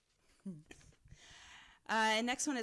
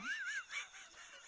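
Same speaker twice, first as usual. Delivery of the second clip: high squeal of laughter; 9 dB quieter than usual. Real, not acted. Reader cracking up at her own ice and ass joke. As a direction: let a squeal of laughter escape.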